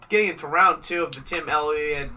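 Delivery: ordinary talk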